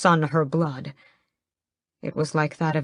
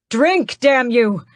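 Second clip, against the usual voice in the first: loud, slow desperate scream; 7 semitones higher, 6 dB louder, slower than usual. A narrator's reading. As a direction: force the words out at him. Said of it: "I scream".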